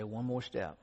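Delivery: soft, tired murmur to yourself; gasping encouragement on a steep climb; weary self-coaxing. Retold or imitated. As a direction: coax yourself up the hill quietly. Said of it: "all you can do is say".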